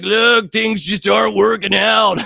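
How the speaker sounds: high, slow, put-on manager voice; mock boss firing. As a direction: let him down gently in a fake boss voice.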